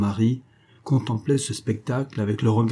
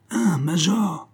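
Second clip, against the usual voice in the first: high drawn exclamation; cried out; 8 semitones higher, much slower than usual. A narrator's reading. Grenadier calls back to the major, crying out. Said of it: "cria le grenadier".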